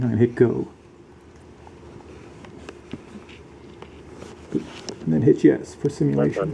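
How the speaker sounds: muffled under a helmet; inside a helmet. Real, not acted. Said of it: "simulate what it sounds like in your helmet".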